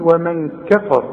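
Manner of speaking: «ومن كفر» بكاف رقيقة وراء مفخمة; رقق كاف «كفر» وفخم راءها; الراء وحدها مفخمة. Shown as a demonstration. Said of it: "علشان الراء مفخمة". («كفر» بتفخيم الراء فقط)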